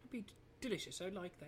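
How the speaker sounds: high, faltering mock British; British accent. Shown as a demonstration. Co-host failing at British, high amateur British accent. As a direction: accept daintily in shaky British. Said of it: "I can't do a British accent".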